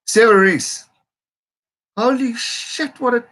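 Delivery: high, excited outburst at a try; real astonished excitement. Real, not acted. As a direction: cry out in stunned delight, high-pitched. Live reaction to a try.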